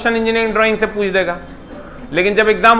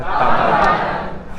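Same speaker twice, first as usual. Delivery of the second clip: breathy laughing answer; much slower than usual. Real, not acted.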